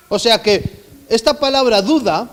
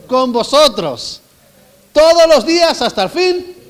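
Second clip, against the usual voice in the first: full-force preaching; 5 semitones higher, 5 dB louder than usual. Acted. Preaching the great commission.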